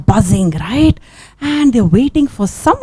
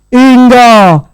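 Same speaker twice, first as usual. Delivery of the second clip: a louder, eerie summons; a hidden voice calls out the name; mysterious calling voice; 4 dB louder than usual. Acted. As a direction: call her name from somewhere hidden.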